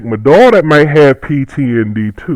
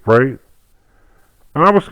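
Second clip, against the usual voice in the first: usual speaking voice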